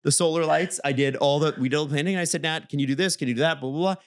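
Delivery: ordinary talk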